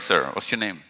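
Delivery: normal talking voice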